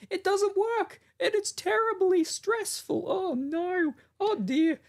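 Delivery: very high fussy Muppet voice; high-pitched mock of a friend; doing co-host's voice. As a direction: squeal it like a flustered puppet. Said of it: "Oh, you're doing me".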